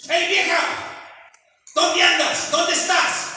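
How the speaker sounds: high, loud shouted call across the house; calling out rudely. Acted. Husband shouting for his wife across the house, loud and high. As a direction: yell for the wife like a rough husband.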